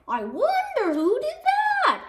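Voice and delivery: high, curious fan voice; innocent wonder. A narrator's reading. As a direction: ask it with mock innocence. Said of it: "asked another fan".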